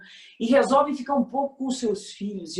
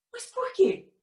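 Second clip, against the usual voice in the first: shocked question; 14 semitones higher, slower than usual. Retold or imitated. Mother questions daughter, shocked.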